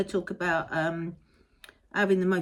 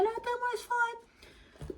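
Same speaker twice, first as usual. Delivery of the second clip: high, soft put-on voice; reassuring conductors; 4 dB quieter, slower than usual. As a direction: lightly mimic a kind conductor. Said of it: "they were like".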